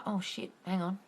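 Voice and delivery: ordinary talk, nothing marked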